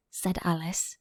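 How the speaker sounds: ordinary delivery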